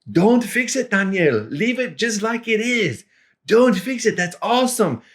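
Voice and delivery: laughing delight. Retold or imitated. Javier delighted by the burrito mix-up, high and gleeful. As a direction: revel in the mistake, grinning. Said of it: "he started laughing and saying".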